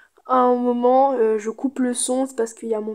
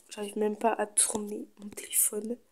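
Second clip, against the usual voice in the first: whispering; 10 dB quieter than usual. Real, not acted. Struggling with phone, whispered aside.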